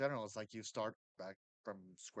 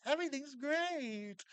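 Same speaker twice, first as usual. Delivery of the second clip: cheery optimist; 14 semitones higher, 5 dB louder, slower than usual. Retold or imitated. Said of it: "there's people like".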